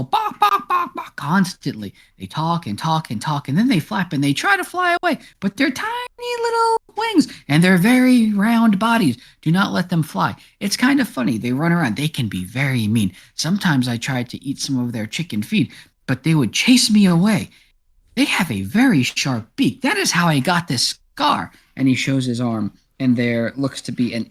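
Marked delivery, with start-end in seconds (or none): 0.0-1.9 high imitation of squawking chickens; chicken clucking. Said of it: "They just go". 2.2-21.5 animated, high-pitched cat character; chatty indignant cat. Acted. Game master plays chatty cat.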